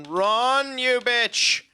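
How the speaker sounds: sudden high yell while fleeing; being chased. Real, not acted. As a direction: shout it as something chases you. Being chased in game, yells.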